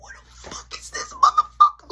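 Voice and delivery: very high whispered shock. Retold or imitated. Kid finding brother in the dryer, a high whispered shock. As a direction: squeak it out in disbelief.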